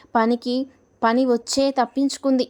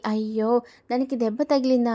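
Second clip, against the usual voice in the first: plain reading voice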